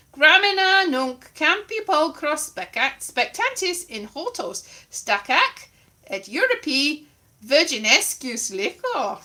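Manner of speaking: Latin read aloud in a bad accent. Reading Ovid to the audience, bad Latin accent. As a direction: declaim Latin with a dodgy accent. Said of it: "bad, bad Latin accent".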